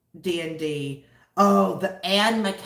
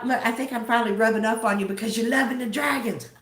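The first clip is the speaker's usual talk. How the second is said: ordinary talk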